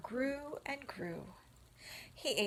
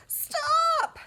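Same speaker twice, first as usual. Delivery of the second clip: much higher, much louder; panicked scream; 12 dB louder than usual. Acted. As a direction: scream it to cut her off. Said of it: "screamed Wilbur".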